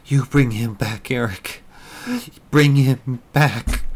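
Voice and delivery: loud, high, desperate demand; slow, insistent order on the last words. Acted. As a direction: widow ordering Eric to return her husband, loud. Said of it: "Melinda demanded".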